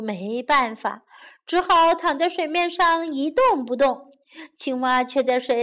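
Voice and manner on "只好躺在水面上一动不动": usual speaking voice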